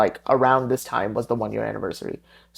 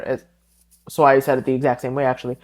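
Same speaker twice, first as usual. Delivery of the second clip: ordinary delivery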